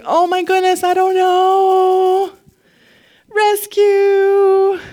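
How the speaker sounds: high, drawn-out mock wail for rescue; helpless plea. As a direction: whine it like a helpless victim on one long note. Mimics believers helplessly begging God.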